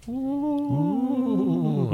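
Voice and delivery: high, long-held spooky "ooh"; eerie mothership ooh. Acted. Host hyping the return of the mothership, eerie high ooh. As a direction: wail it like a flying saucer.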